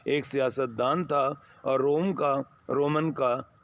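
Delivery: usual speaking voice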